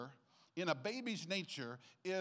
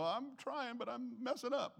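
whiny churchgoer; 9 semitones higher, 3 dB quieter than usual. Retold or imitated. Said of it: "somebody in this room might go".

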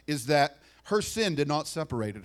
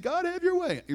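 high plea; 3 dB louder than usual. Retold or imitated. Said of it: "when you're saying".

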